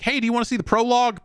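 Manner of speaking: high, fast, eager little host voice; game asking politely. Retold or imitated. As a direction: play the game as an overly friendly greeter, quick and high. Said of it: "it's like".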